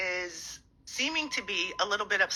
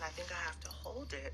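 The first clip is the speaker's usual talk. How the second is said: soft unvoiced whisper; 12 dB quieter than usual. Real, not acted. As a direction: whisper so the house stays quiet. Whispers while kids get ready.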